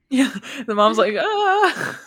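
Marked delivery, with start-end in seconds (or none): 1.2-2.0 a much higher, louder startled yelp; mock panic from a worried mother; alarmed mom.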